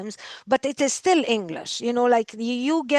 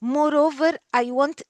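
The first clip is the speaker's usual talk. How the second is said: ordinary talk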